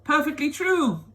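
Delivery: voce alta e acuta da nonna anziana; old grandma crying out. Acted. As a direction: lancia la battuta come una vecchia nonna entusiasta. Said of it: "cried Grandma Josephine".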